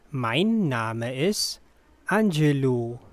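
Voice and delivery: careful, unhurried teaching model. Shown as a demonstration. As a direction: break it in two for learners. Teacher repeats slowly with pause.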